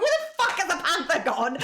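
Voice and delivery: high-pitched, incredulous retelling; baffled outburst. Retold or imitated. Player retelling a panther vanishing, baffled and high. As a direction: act out mock bewilderment, pitched well up. Acting own baffled reaction in game.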